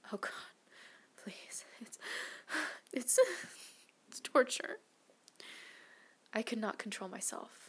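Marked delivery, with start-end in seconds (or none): 0.1-4.8 broken, gasping plea; whimpering. Acted. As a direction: whimper it haltingly, catching the breath. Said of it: "A whimper of pleasure".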